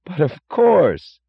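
startled enthusiasm, high, loud and drawn out; Lawson. A narrator's reading. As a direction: cry out in eager surprise. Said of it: "cried Lawson with startled enthusiasm".

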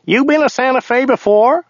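high, nasal driver voice. A narrator's reading. Driver asking his passenger about Santa Fe, high nasal voice. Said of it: "nasaled Joe".

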